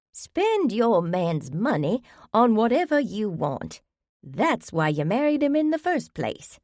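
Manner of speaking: high, whiny complaining wife voice. Acted. Sheldon mocking bad advice for the women, high and whiny. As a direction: whine it out high like a spoiled spouse. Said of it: "in a high, whiny voice".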